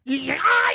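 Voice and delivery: high, loud electrocuted howl; re-enacted shock yell. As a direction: howl as the jolt hits. Re-enacting shock from spark plug.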